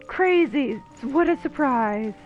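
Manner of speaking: ordinary talk, nothing marked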